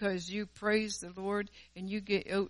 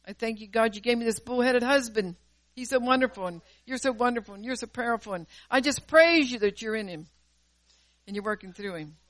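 mock gushing praise; 3 semitones higher, 8 dB louder than usual. Ironic praise for bullheaded husband.

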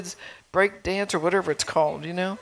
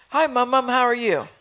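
put-on bright-eyed child's greeting, higher pitched; lightly voiced little child; 5 semitones higher, 4 dB louder than usual. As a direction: imitate a small child popping up to say hi. Child interrupts her late-night study.

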